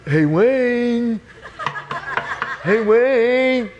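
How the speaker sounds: high, drawn-out call through a door. Retold or imitated. Friend outside the door at one a.m., calling. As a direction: sing out the name, high and stretched. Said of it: "then I hear this voice".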